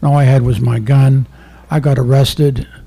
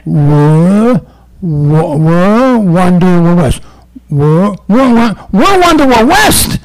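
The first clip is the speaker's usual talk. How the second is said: loud, stammering, climbing ever higher; stammering suspect sounding it out; 5 semitones higher, 6 dB louder, much slower than usual. Retold or imitated. Suspect struggling to read the shirt aloud, loud stammer climbing high. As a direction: sound out each syllable, pitch rising to a yelp. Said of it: "He's going".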